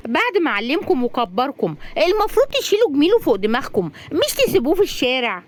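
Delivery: high, scolding female-character voice; indignant young woman. Acted. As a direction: lecture him with rising indignation. Razan scolds Magdy in story.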